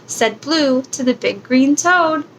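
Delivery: ordinary delivery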